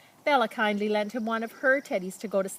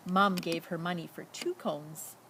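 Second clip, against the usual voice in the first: ordinary delivery, nothing marked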